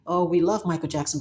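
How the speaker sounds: normal talking voice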